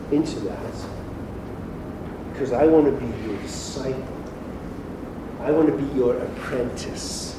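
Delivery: soft, hushed, sincere prayer to Jesus. Acted. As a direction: pray it quietly, as your own surrender. Prays on behalf of listeners.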